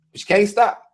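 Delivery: ordinary talk, nothing marked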